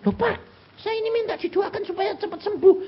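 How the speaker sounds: high, puzzled voice of a sick churchgoer; complaining congregant. Retold or imitated. Sick congregant protesting to the pastor, high and puzzled. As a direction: whine it up high like the confused patient.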